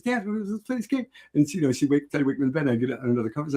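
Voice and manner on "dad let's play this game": put-on high eager child voice pleading; be the kid begging dad to play; imitating little daughter. Dad imitating his small daughter waking him to play, eager. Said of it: "She goes".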